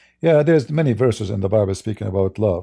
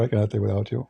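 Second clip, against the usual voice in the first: usual speaking voice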